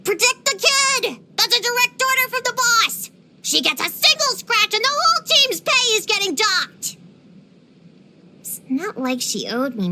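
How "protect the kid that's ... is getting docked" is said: high, urgent shouted orders; acted shout of a caravan leader, pitched up. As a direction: call out a stern order to the team. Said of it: "the caravan leader shouted".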